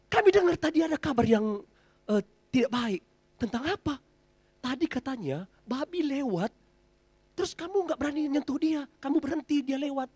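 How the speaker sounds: lightly voiced elephant herd asking what happened; elephants questioning. Elephants ask their friend why he let the pig pass, lightly voiced.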